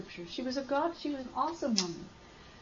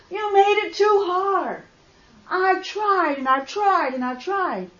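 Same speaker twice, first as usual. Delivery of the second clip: screaming at God; 6 semitones higher, 13 dB louder than usual. Retold or imitated. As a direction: cry out in anguish and give up. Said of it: "I'm screaming at God".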